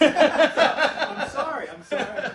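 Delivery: sorry said through laughter; laughing apology. Real, not acted. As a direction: apologize while laughing hard.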